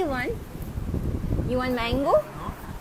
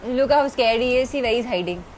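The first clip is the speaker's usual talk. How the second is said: ordinary delivery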